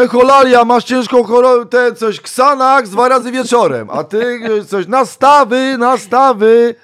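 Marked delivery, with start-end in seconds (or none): 0.0-3.9 very high, loud stage voice; mock diva doctor. Diva diagnosing fan. 4.9-6.7 very high, loud drawn-out call; mock diva. Parody of diva.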